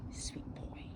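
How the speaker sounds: soft whisper to the dog; whispering to the dog while petting him. Real, not acted. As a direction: breathe it tenderly to the puppy.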